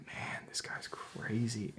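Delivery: slow, soft whispered amazement; awed whisper. Retold or imitated. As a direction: breathe it out in awe. Awed by the man's story.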